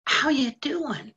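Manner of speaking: imitating him. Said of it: "and goes".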